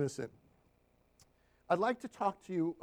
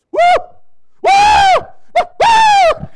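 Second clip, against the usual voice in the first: very high, much louder whooping woo; ecstatic whoops; 19 dB louder than usual. Retold or imitated. Client leaping off the couch, shrieking loud high whoops. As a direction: whoop it out as the power hits. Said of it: "She goes".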